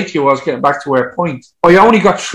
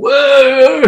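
high drawn-out whoop of a group of lads; whooping, much higher than speech; imitated cheering; 12 semitones higher than usual. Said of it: "doing all the cheering".

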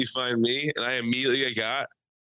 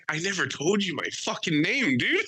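higher-pitched incredulous retort; comic disbelief; mock alarm; 6 semitones higher than usual. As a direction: act freaked out at being recognized. Retold shocked reaction to bar man.

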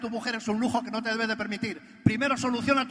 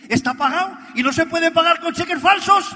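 loud, higher, gloating punchline; triumphant retort; 7 semitones higher, 12 dB louder than usual. Retold or imitated. Telling Miguel the Mercedes is paid, loud gloating retort. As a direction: hit him with it loud and high.

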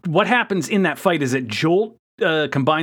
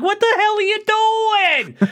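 high, slow, outraged cry; shocked yell; slower than usual. Retold or imitated. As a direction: shriek it in disbelief. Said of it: "and Hotshot's like".

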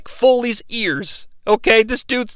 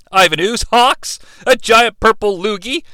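normal talking voice

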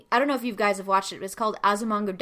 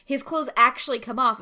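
ordinary talk, nothing marked

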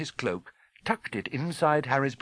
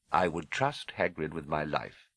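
put-on low, measured old wizard; calm wise headmaster; 5 semitones lower, 3 dB quieter than usual. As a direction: assure quietly and steadily, pitched low.

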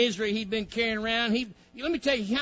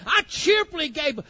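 joyful shout; 6 semitones higher, 5 dB louder than usual. Real, not acted. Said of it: "preacher shouting with joy".

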